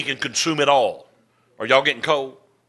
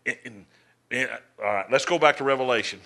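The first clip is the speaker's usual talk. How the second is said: usual speaking voice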